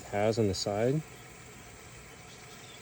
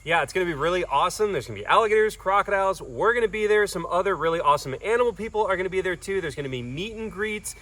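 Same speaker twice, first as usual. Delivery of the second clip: excited promo; 6 semitones higher, 5 dB louder than usual. Real, not acted. Promo announcement.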